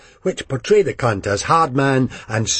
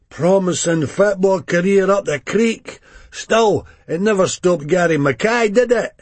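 exuberant junkie; 9 semitones higher, 4 dB louder than usual. A narrator's reading. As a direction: greet him with big bravado. Johnny voiced in the story.

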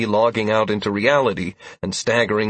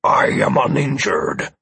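slow, growled reply; angry growl; 3 semitones lower, slower than usual. A narrator's reading. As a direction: growl it out slowly. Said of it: "Amaranth answered angrily, letting out a low growl".

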